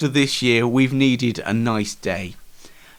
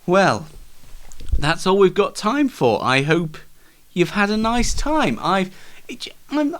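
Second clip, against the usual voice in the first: ordinary delivery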